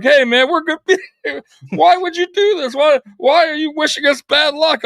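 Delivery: high whiny mock voice; mocking fans. Rival fans complaining in comments, high whiny mock. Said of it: "Like, like".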